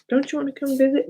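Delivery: put-on high, quick child's pleading question; imitating grandkids. Grandkids inviting Grandma to come visit, imitated high and quick. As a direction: play a grandchild coaxing Grandma. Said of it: "The grandkids call me and say".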